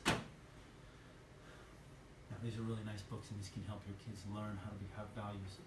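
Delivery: low, soft pitch with no inflection; Mike's lifeless sales pitch. Retold or imitated. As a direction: imitate a timid salesman mumbling his pitch. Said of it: "go like this".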